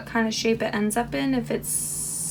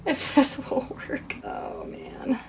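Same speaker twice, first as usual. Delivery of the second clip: nervous whisper; 2 semitones higher, 5 dB quieter, slower than usual. Real, not acted. Nervous moment of truth.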